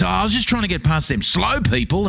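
high, whiny put-on excuse; mocking the old bloke. Mocking the old man's excuse, high and whiny. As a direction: whine it like a hurried old man. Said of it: "He goes".

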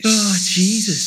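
normal talking voice

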